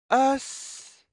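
usual speaking voice

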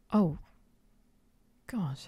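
ordinary talk

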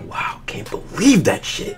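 breathy whisper, slow and defeated; whispered disbelief. Retold or imitated. Alone in his car after leaving the store, slow defeated whisper. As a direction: whisper it to yourself, slowly.

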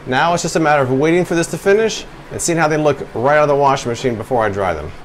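normal talking voice

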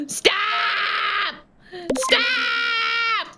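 playful begging to stop; building mock cries. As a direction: escalate the fake panic. Character begging in game.

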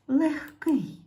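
ordinary talk, nothing marked

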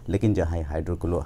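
normal talking voice

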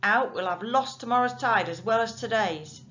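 loud impatient shout down to the barge. A narrator's reading. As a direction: yell it down impatiently.

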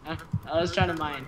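usual speaking voice

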